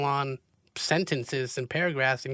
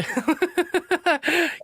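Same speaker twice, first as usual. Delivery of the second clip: bright laugh, pitch jumping up; a quick surprised laugh at herself; laughing at her own broken English; 14 semitones higher, 3 dB louder than usual. Real, not acted.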